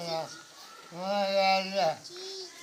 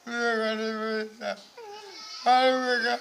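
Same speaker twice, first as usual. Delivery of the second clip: real sobbing, higher and louder; words break into tears; crying; 6 semitones higher, 3 dB louder than usual. Real, not acted. Old father sobbing to his daughter, real crying. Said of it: "रो मत।".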